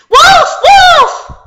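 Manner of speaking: very high, very loud yell; piercingly high false alarm; crying wolf. Acted. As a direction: shout the alarm at full voice. Boy cries wolf a second time.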